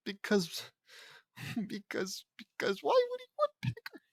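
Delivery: cracking up, voice squeaking high; laughing. Real, not acted. Reader losing it over a clumsy story, laughing. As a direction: laugh through the words, losing it.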